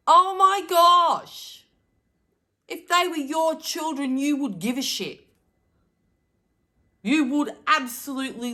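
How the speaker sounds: loud, high, exasperated outburst; impassioned. Real, not acted. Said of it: "You can hear my passion".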